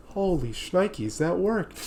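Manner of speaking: relieved hush. Retold or imitated. Tommy relieved quietly.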